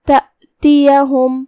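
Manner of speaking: slow recitation of تأتیہم with a clear open ya; clear ya with zabar. Shown as a demonstration. Teacher models the word for student, slow and clear. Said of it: "یا کو زبر سے ادا".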